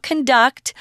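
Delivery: "conduct" stressed at the end, con-DUCT; weak first syllable, punch the "duct" in "conduct"; stress on second syllable. Shown as a demonstration. Teacher contrasting the verb with the noun, second-syllable stress on "conduct". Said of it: "which we usually use as a verb".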